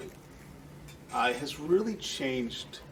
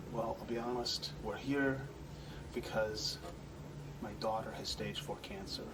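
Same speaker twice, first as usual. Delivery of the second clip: grieving father; 4 semitones lower, 9 dB quieter than usual. Retold or imitated. Father telling the manager about his sick daughter, hushed and grieving. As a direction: let the voice drop away under the weight of the news. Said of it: "And he goes".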